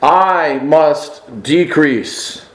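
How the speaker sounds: very slow, each phrase weighed; slow solemn quote. Retold or imitated. Preacher quoting John's humble statement, very slow. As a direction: let each word settle. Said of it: "that classic statement".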